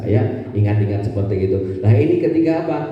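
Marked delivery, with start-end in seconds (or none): none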